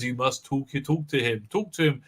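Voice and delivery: low, soft, grave advice; a hint of a solemn officer; lightly voiced Saru. Saru advising Burnham, low quiet gravity.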